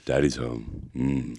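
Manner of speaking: low, slow "daddy's home"; deep daddy voice. Pretend. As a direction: purr it like a cheesy seducer. Mock seductive daddy voice.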